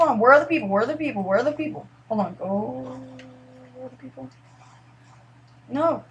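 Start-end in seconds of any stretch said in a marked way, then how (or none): 3.7-4.3 barely audible whisper to oneself. Real, not acted. Gamer alone in an empty lobby, whispering to oneself. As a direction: whisper it under your breath.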